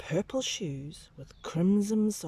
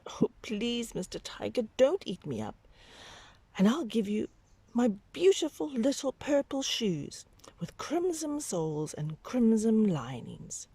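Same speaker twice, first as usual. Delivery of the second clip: put-on pleading child; 7 semitones higher than usual.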